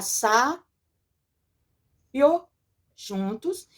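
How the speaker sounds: "sa-, io" with a long gap after sa; syllable by syllable. Shown as a demonstration. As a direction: split sábio in two, sa then bio. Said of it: "vamos separar as sílabas delas".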